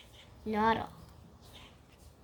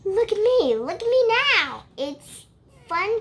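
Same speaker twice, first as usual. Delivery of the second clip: show-off cat; 9 semitones higher, 9 dB louder than usual. Acted. Cat showing off in story.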